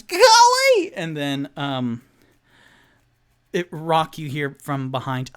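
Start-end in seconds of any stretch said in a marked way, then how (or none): 0.0-0.9 very high, very loud shriek; yelling. Acted. Rogue shrieks at the creatures to go away. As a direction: shriek it to drive them off.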